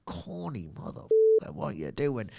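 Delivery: a touch of a mock rant; ranting guy. Fan voicing his ranting side, mild mock scorn. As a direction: scoff at it lightly. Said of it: "the ranting guy that I can sometimes be".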